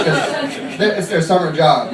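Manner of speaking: ordinary talk, nothing marked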